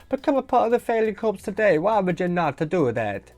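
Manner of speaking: high-pitched cartoon Italian sales pitch; mock Italian accent. Podcaster plugging merch to viewers, accidental mock Italian accent. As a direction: slip into a hammy Italian accent. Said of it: "I don't know why I went Italian".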